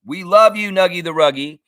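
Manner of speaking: high, loud cheer; excited shout-out. Real, not acted. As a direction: call out your love to a friend. Shout-out to friend in chat.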